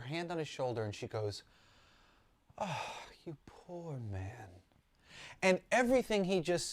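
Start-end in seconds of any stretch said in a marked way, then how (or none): 2.6-4.5 soft, slow and tender, imitating Mrs Claus; innocent pity. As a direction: gently pity him, like an innocent, kind old lady. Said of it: "goes straight over to him so innocently".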